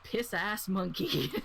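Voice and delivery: slow, giggling mock name; mocking through laughter. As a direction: laugh while mangling the title. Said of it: "making fun of it".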